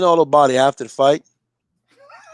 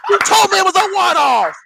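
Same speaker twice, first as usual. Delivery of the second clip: mock complaint; 6 semitones higher than usual. Voicing fighter blaming team.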